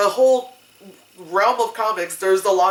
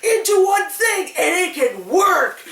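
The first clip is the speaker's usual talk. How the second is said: excited fans; 10 semitones higher than usual. Retold or imitated. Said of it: "people were like".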